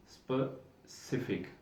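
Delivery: स्पेसिफिक very soft and slow, "spe-si-fik"; soft slow model. Shown as a demonstration. Teacher to students, softly modelling three syllables. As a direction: breathe स्पेसिफिक out gently, syllable by syllable. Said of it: "तीन syllables के ऊपर ये मुश्तमिल लफ्ज़ है".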